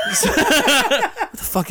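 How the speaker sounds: high, slow, laughing through it; can't stop laughing. Real, not acted. Laughing at the boss.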